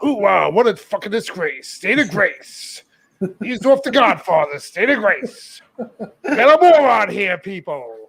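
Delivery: loud gung-ho soldier; bellowing army colonel, slow and hammy; Frank Slade impression. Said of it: "let me get back to normal voice".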